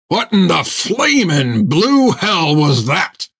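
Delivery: slow, high furious yell; angry shout. A narrator's reading. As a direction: roar it at the crew. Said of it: "shouted Jack".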